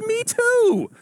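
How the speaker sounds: very high, loud squeal; hammed-up peak of joy; ecstatic agreement. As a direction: shriek it with delight at the match. Joke: growing delight at match.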